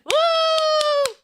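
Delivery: high loud cheering; excited cheer. Pretend. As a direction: cheer like the curtain rose. Cheering as show begins.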